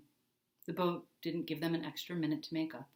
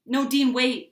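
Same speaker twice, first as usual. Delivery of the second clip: higher, much louder plea; cried out; 7 semitones higher, 13 dB louder, slower than usual. A narrator's reading. Ginny calls after Dean as he leaves, loud and pleading. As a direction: cry out to stop him. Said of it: "Ginny cried".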